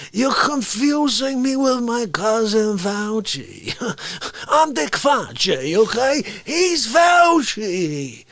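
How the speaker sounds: high, drawn-out hammy character voice; cocky Fauci-style character. Acted. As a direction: stretch the words like an exasperated blowhard.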